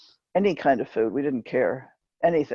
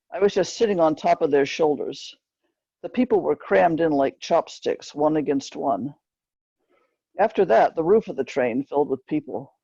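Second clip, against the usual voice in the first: usual speaking voice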